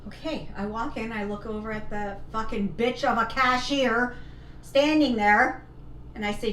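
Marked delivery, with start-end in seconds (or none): none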